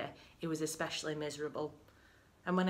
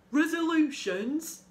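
loud, high, drawn-out incredulous roar; 8 semitones higher, 8 dB louder, slower than usual. A narrator's reading. Husband mocks his wife's talk of resolutions, roaring. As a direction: roar it as a disbelieving question. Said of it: "he roared".

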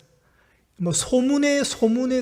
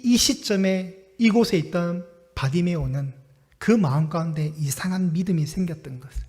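ordinary talk

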